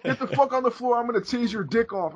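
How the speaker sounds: high hammed-up cop yell; mocking how the cop yelled. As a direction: ham it up as a crazed cop. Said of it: "They're never like".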